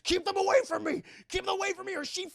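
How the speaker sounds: high, loud frantic shouting; panicked threat. Retold or imitated. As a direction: scream the threat as killers close in. Retold hostage threat in panic.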